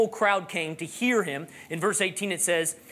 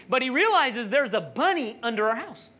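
ordinary talk, nothing marked

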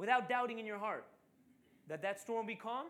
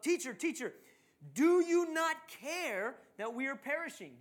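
loud, raised plea of alarm; panicked disciples; 6 semitones higher, 8 dB louder, slower than usual. Acted. Frightened disciples waking their sleeping teacher, panicked. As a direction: cry out, drawing out the desperate plea. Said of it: "here they're freaking out".

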